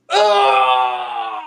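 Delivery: loud drawn-out "ah" yell; sustained hammed-up scream; mock scream. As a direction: cry out loud and long. Mock shouting bit, spelt scream.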